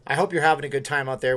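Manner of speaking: ordinary delivery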